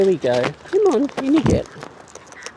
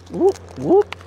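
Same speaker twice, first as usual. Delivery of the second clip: high whoop call; 12 semitones higher than usual. Real, not acted. Calling birds down.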